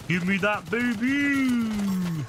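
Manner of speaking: high, drawn-out gleeful cry; excited. Real, not acted. Gamer cheering loot.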